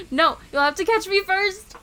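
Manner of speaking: loud, high, excited dare; playful taunt. Acted. Speaker escapes the tickling partner, loud playful taunt. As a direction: call it out while escaping tickles.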